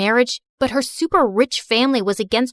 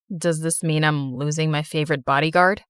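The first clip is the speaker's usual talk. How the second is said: put-on a lower young man's voice, teasing; lower male voice; 9 semitones lower than usual. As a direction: play him lower, gently teasing. Man speaks in voiced male role.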